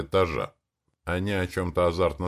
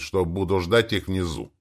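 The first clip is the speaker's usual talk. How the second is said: ordinary delivery, nothing marked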